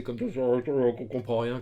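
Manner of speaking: slow muffled mumble; Bane. Shown as a demonstration. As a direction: garble it behind a mask. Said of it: "que Bane, il parle comme ça".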